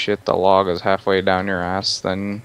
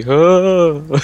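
loud, higher-pitched "uh"; demonstrated noise; 6 semitones higher, 6 dB louder than usual. Shown as a demonstration. Prompting a friend to do the noise, loud high "uh". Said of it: "can you do the".

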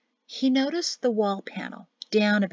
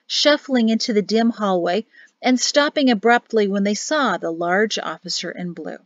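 ordinary delivery, nothing marked

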